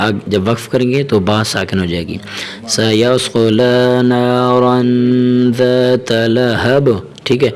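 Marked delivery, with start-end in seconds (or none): 2.8-6.9 very slow, melodic recitation; Quran recitation. Shown as a demonstration. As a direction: chant the verse slowly. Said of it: "recites the verse".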